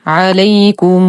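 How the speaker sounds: عليكم recited slowly with the final م drawn long; slow tajweed recitation. Shown as a demonstration. Teacher recites the word for students, slow measured chant. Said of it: "ونصل ميم الجمع بواو مادية تمد بالإشباع".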